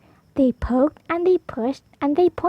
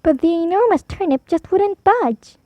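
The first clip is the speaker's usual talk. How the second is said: plain reading voice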